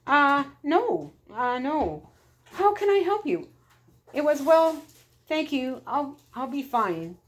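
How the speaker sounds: high, flustered Irish woman fumbling for words; flustered Missus Flaherty. A narrator's reading. Missus Flaherty missing the plane, answering the attendant flustered and high.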